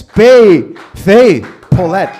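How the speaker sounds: loud drawn-out name call; big announcer intro. Shown as a demonstration. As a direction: belt her name to the room. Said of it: "Just said your name wrong".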